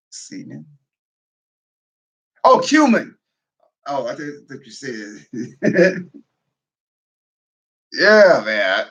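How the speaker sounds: ordinary delivery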